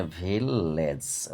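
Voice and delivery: ভিলেজ slow, long ল in the middle; split in two syllables. Shown as a demonstration. Teacher breaking a word for pupils, ভিলেজ slowly as ভিল-লেজ. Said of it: "দুবার উচ্চারণ হচ্ছে".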